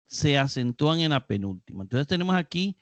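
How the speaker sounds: ordinary delivery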